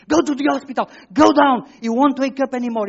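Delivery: high, loud and urgent, panic rising; panicked inner voice. Retold or imitated. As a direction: shout it like alarm bells ringing in your head. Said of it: "this kind of voices immediately".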